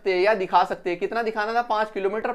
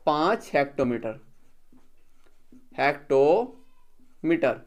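slow dictation; 4 semitones lower than usual. Shown as a demonstration. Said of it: "इसके ऊपर लिखना है".

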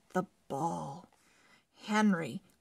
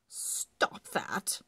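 firm. Acted. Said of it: "she said firmly".

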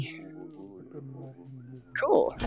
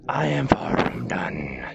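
dramatic whisper; 7 dB quieter than usual. Acted. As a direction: growl it low like a villain. Dramatic defiant low voice.

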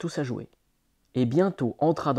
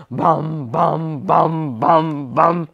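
louder and louder bam bam; loud drum beats; 9 dB louder, much slower than usual. A narrator's reading. Poco beating harder on the drum, loud chant. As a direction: pound out each bam.

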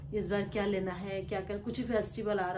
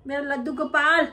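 imitating grandma; 5 semitones higher, 9 dB louder than usual. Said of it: "दादी ऐसे बोलते हैं कि".

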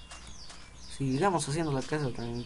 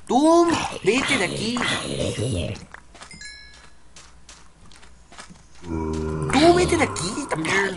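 grito de ahuyentar; 3 semitones higher, 4 dB louder, slower than usual. Real, not acted. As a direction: shoo it off loudly. Ahuyentando a un enemigo. (echándolo a gritos)

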